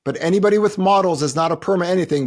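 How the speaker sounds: ordinary talk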